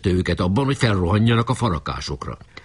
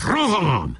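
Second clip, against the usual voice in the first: high, long battle cry; rallying commander's shout; shouted charge; 9 semitones higher than usual. Acted. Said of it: "bekiáltott".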